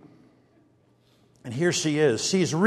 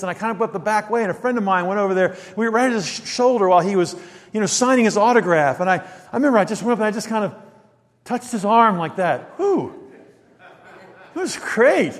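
ordinary delivery

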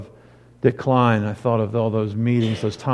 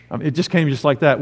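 ordinary talk

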